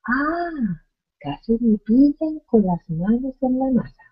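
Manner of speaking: high, startled ah of being nearly caught; exclamation. Shown as a demonstration. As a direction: read it like you were almost caught red-handed. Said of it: "Interjección exclamativa".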